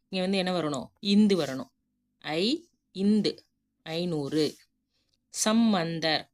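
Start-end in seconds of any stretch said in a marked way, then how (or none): none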